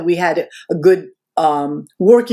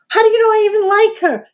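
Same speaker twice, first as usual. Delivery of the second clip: very high-pitched, baffled objection; retold incredulous challenge. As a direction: throw it back in disbelief, pitch way up. Retold [name] challenging [name]'s claim.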